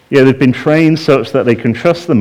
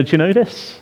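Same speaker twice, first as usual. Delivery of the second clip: usual speaking voice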